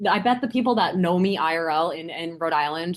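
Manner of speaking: normal talking voice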